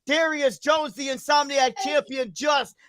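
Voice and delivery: match commentary building to an excited call; wrestling play-by-play. Acted. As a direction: build up to the big leap off the ladder. Calls an imaginary wrestling match.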